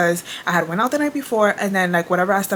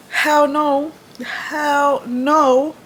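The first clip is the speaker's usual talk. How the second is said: higher, laughing-crying hell no; 5 semitones higher, much slower than usual. Real, not acted. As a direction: refuse with mock despair. Emphatic refusal.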